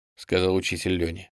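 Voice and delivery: usual speaking voice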